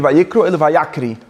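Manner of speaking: Vayikru then Vayakri, the vowel changed from i to a; reading contrast. Shown as a demonstration. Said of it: "Er sagt Al-Tikri".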